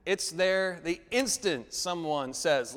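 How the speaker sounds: ordinary delivery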